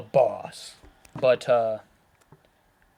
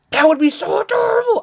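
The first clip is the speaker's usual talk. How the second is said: very high, louder squeal of delight; adorable squeal; 5 dB louder than usual. Real, not acted. Imagining a puppy Odogaron, high delighted squeal.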